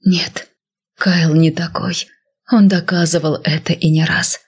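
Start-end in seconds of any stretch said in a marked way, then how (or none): none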